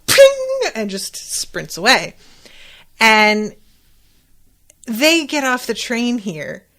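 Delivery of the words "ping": high, loud cartoon ping; ping sound. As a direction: chirp it out like a comic sound effect. Sound effect for the reveal.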